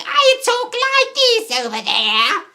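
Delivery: very high, loud, slow English caricature; mock English accent. Streamer teasing English friend, exaggerated high English voice. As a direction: squawk a hammed-up English accent. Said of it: "I always make those voices".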